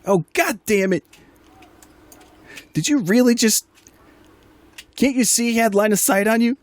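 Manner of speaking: high-pitched put-on woman's voice, mock yell; exasperated woman yelling. Woman scolding teammate over a missed line of sight, high mock yell. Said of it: "this woman here in the very bottom right corner, uh, yell out".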